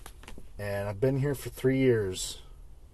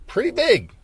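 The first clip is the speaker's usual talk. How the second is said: over-the-top emphasis; 12 dB louder than usual. Acted. Playful overstatement about YouTube.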